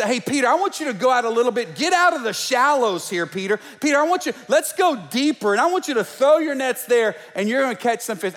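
eager preacher-voiced Jesus calling out to Peter; Jesus urging. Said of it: "but it's".

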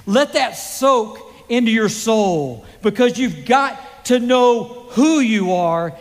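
high, loud, rising sermon delivery; full-force preaching. Acted. A preacher tells his congregation they are God's children, full force. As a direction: proclaim it to the whole congregation.

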